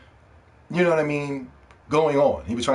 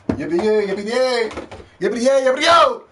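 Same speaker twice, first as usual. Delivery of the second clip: higher, building to a loud yippee yo; gleeful cheer; 8 semitones higher, 4 dB louder than usual. Real, not acted. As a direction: chant it like a happy cheer. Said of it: "cheering over new games".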